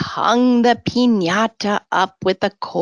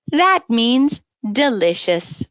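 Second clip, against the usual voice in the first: ordinary delivery, nothing marked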